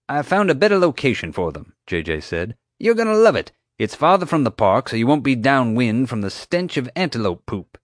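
breezy park owner brushing it off; lightly voiced park owner; mild showman voice. JJ selling the move.

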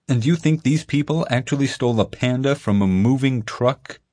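lightly voiced skeptical kid; doubtful. A narrator's reading. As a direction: question it with open disbelief. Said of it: "Ethan asked doubtfully".

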